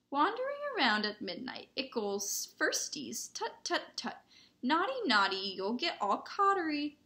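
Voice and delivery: high, sing-song mischievous taunt; Peeves. A narrator's reading. Poltergeist taunting first years at midnight, sing-song mockery.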